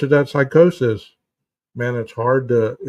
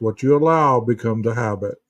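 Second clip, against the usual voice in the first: normal talking voice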